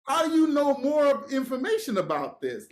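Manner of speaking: high, heated retold question; mad. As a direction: demand to know, worked up. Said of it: "'cause I'm mad".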